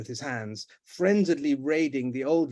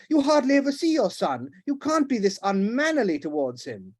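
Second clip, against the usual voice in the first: loud, much higher reproach; indignant protest; 7 semitones higher, 4 dB louder than usual. Acted. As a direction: defend her son hotly. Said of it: "protested the Duchess".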